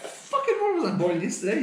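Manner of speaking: high-pitched, disbelieving outrage; incredulous rant. Real, not acted. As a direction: scoff at being taken for a fool. Ranting at company.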